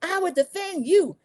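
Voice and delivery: high-pitched mock-upset accent; hammed-up high voice, put-on accent; Kimmy in an argument. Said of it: "trying to do their accents and these loud, horrible arguments".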